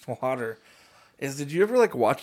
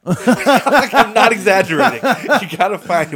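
high, loud, laughing protest; laughing insistence; 6 semitones higher, 12 dB louder than usual. Real, not acted. Insisting to a friend the show is real, laughing. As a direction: swear it's true through laughter.

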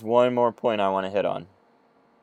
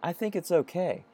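timid prince; 8 semitones higher than usual. Retold or imitated. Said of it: "one of the princes says".

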